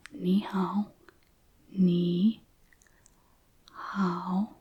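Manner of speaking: "ni hao" with dipping syllables, then drawn apart; third tone. Shown as a demonstration. Teacher showing third tone in a greeting, dipping "ni hao". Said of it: "same tone that we found in".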